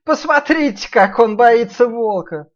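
high loud teasing piglet; mock him, high and slow. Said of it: "сказал Ниф-Ниф".

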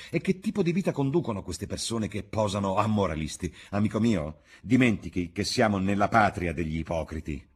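Dorian, proud and contemptuous, pushing back hard; scornful young dandy. A narrator's reading. Dorian's scornful defiant speech.

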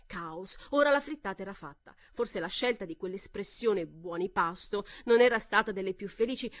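ordinary delivery, nothing marked

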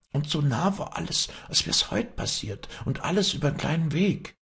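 put-on high-pitched old woman's voice, foreboding; worried old nurse. Old nurse reveals the cards to the girl, foreboding. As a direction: tell the omen in a high, anxious old voice.

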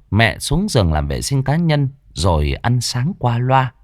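ordinary delivery

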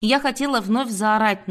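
usual speaking voice

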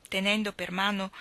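ordinary delivery, nothing marked

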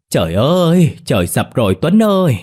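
loud, high-pitched howl; yelping in pain. A narrator's reading. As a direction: cry out as if the sky fell. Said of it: "ôm má kêu oai oái".

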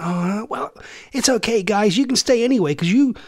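high-pitched imitation American girl; American accent. American girl letting the guests stay, imitated American accent. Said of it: "just put on American accents".

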